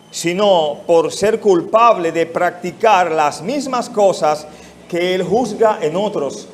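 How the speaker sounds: ordinary talk, nothing marked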